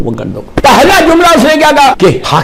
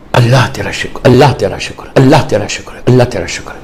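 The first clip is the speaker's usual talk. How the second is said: grateful prayer; 5 semitones lower than usual. Retold or imitated. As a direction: murmur thanks humbly. Man thanks God in prostration.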